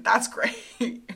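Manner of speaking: slow, drawn out through a laugh. Real, not acted. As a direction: laugh the word great out slowly. Laughs mid word.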